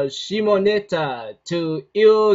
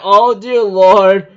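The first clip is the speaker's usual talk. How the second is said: exasperated; 9 dB louder, slower than usual. Retold or imitated. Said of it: "I think in my mind".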